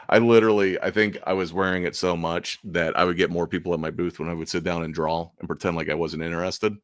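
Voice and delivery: usual speaking voice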